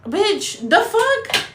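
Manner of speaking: high, incredulous the fuck; shocked squeak; mock disbelief. Reacting to the app warning, high mock disbelief. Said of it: "I'm like".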